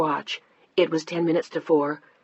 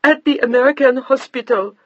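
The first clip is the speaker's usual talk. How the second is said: sobbing; 8 semitones higher, 7 dB louder than usual. A narrator's reading. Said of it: "The old woman was sobbing now".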